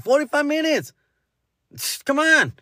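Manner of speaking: high-pitched playful indignation; mock protest. Host telling listeners the episode is over, mock protest.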